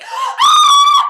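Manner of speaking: piercing high mock scream; very loud high scream. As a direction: shriek like a frightened girl. Goldilocks screams.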